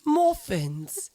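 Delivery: mock highbrow British commercial voice, slow and drawn out; hammed-up, deliberate upper-crust British announcer; highbrow British accent. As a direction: sell it as an upper-class British advert, savoring each word. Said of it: "okay, highbrow muffin commercial?".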